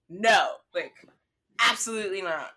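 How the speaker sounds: firm re-enacted no, loud at first; retold pissed-off refusal. Traveller refusing to miss the trip, retold pissed-off refusal. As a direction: hit NO hard, then settle. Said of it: "I woke up pissed".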